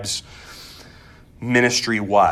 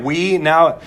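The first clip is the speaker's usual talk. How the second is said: usual speaking voice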